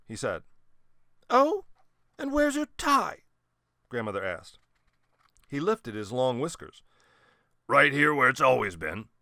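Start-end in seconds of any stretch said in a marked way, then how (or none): 1.3-3.2 put-on high, loud prim old lady; an old lady scolding a guest; high grandmother voice. A narrator's reading. Grandmother questions Whiskers. 7.7-9.1 put-on loud gruff old sea captain; a lightly voiced old captain, growling back; growled. A narrator's reading. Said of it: "he growled".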